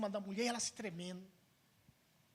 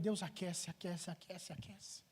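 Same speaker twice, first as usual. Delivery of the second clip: soft repeated plea, fading away; murmured prayer; 8 dB quieter, slower than usual. Retold or imitated. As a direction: imitate a desperate prayer, quieter with each repeat. Retold desperate prayer over freezing wife. (súplica repetida em voz baixa, sumindo)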